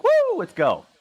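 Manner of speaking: very high, loud mock cheer; elated whoop. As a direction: whoop like you're raring to go. Acts elation at recovered knee.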